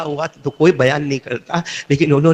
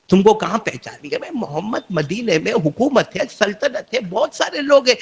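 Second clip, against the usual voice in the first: Satan's taunting whisper; 7 semitones higher than usual. Acted. Satan taunts the young traveller, building to a mocking shout. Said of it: "شیطان ان کو ایک خیال ڈالتا".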